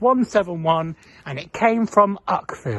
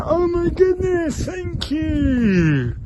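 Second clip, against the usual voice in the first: high, thrilled thank you; delighted thanks; 10 semitones higher, much slower than usual. Retold or imitated. Tom thanking the guard for the headrest, high and delighted. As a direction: gush with delight. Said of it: "I was like".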